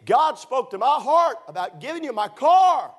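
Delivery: high, loud, eager offer; eager insistence. Retold or imitated. Joe insisting the preacher take his car, eager. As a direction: press the gift on him eagerly. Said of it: "he said".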